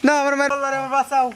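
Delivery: frantic, repeated pleading for life; panicked begging. Acted. Victim begging an attacker for mercy, panicked. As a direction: plead in sheer panic, words tumbling out.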